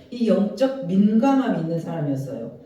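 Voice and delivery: ordinary talk, nothing marked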